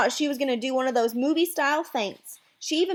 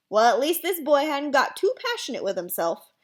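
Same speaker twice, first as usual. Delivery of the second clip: ordinary delivery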